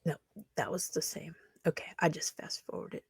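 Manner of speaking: soft whispered aside; quiet whispered self-talk. Real, not acted. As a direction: mutter it under your breath to yourself. Muttered aside while scrolling.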